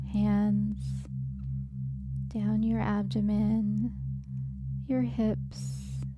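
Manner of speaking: normal talking voice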